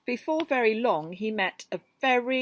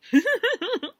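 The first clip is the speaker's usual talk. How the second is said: high squealing rhino giggle; loud high-pitched giggle; 9 dB louder than usual. Acted. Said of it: "He giggled".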